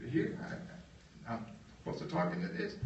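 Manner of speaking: breathy, hesitant, barely voiced; hushed unsure. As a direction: ask it timidly, like you're not sure you should. [name] unsure at the microphone.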